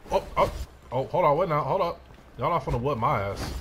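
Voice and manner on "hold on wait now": ordinary talk, nothing marked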